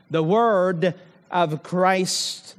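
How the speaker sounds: "The word of Christ" slowly, weight on "word"; stress on word of Christ. Shown as a demonstration. Preacher repeating the key phrase, slow and emphatic. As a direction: hold each word of "The word of Christ". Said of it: "I emphasized here".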